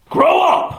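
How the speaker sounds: high, annoyed retort; exasperated roommate. Acted. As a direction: mimic a fed-up roommate scolding.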